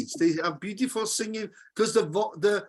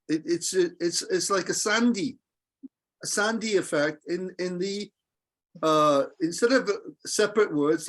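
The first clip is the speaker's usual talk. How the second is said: normal talking voice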